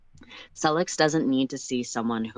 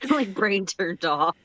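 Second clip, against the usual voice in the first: laughing; 7 semitones higher, slower than usual. Real, not acted. Laughing along with partner.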